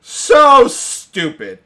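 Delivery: dragging out so stupid, high and loud; furious disbelief. Retold or imitated. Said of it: "I was like".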